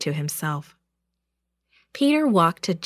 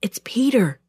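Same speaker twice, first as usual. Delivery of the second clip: plain reading voice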